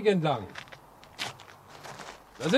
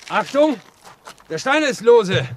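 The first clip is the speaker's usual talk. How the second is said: louder, higher sudden warning; 4 semitones higher, 6 dB louder than usual. Acted. Railwayman warns the journalist of a loose stone, loud. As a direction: alert him to the loose stone.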